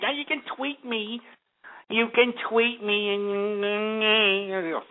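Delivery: lilting mock-dainty voice, slow and drawn out on the second line; sneering, hammed-up dainty voice; mocking fruity voice. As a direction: mince the words to mock them. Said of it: "such a fruity ass word".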